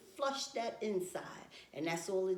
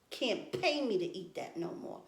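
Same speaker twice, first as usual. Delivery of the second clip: ordinary talk, nothing marked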